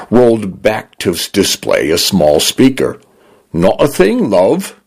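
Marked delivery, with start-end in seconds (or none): 3.5-4.8 a lightly voiced Cockney-leaning British house, higher; a hint of a chirpy Cockney helper; light British Cockney-tinged voice of a smart home. A narrator's reading. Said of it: "male British voice that leaned toward a Cockney accent".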